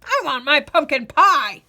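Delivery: loud, cracked whine; old man whining for pie. Acted. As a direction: demand it like a sulky old man.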